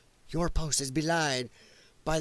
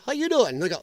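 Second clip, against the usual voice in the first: ordinary delivery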